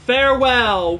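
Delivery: loud, mock-heroic farewell call; mimicking the NPC's farewell. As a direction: belt it like the game character. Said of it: "before he does farewell".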